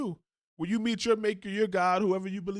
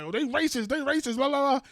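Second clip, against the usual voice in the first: mocking Roland Martin; 7 semitones higher than usual. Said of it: "sit there and be like".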